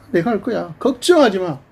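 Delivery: high, rising reassurance; God calming his anxious people; voicing God. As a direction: promise it like a comforting father.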